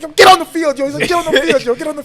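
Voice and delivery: high, loud yelled orders; coach yelling. Retold or imitated. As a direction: imitate a coach hollering at a player.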